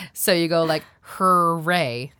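"hooray" drawn out slowly with a bunched American R. Shown as a demonstration. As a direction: stretch "hooray" and sound its R with the tongue cupped. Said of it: "feeling like the sides of my tongue".

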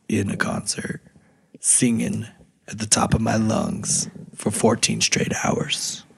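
raspy whisper. Real, not acted. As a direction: voice nearly gone, breathy. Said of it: "partial voice now".

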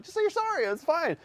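very high, tight dad voice; frustrated dad to his daughter, high and strained; strained high plea. Retold or imitated. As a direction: squeeze it out high, barely holding it together. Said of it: "I'm like".

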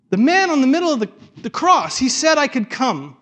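high, loud, stretched-out declaration; emphatic proclamation. Acted. Thief telling the angel why he may enter, loud emphatic proclamation.

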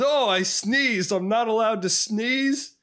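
high, slow mock-offended protest; indignant husband impression. Husband to wife after scaring off animals, high indignant protest. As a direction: protest high, stretching the words. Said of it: "And he says".

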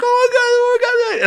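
shrieky, extremely high fan squeal; imitated excitement. Son picking up his father, mock ecstatic squealing. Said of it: "he's just as excited as me".

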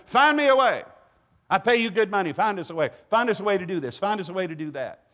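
high, loud boss voice demanding results; demanding CEO. Retold or imitated. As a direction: bark it like a pushy CEO. CEOs demanding a way.

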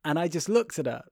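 normal talking voice

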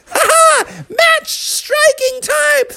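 put-on gleeful kid bear. Junior snatches the matches gleefully.

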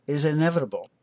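ordinary delivery

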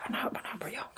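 soft, insistent mock whisper; whispered denial. Co-host denying that they are old, whispered denial. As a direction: whisper it like a pretend self-reassurance.

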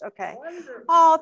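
ordinary delivery

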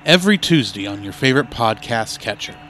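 punchy trailer tagline; announcer voice. Acted. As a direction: sell the new show. Promo tagline read.

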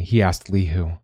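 plain reading voice

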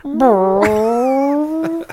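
long, loud buzzing raspberry with the lips; fart noise. Retold or imitated. Man answering a telemarketer with a long raspberry. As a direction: blow a big wet fart noise. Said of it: "held it up my ass and was like".

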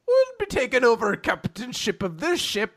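high-pitched mock Irish; Captain Connor. Captain stands up to take over, mock Irish. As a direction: declare it as a hammed-up Irish captain.

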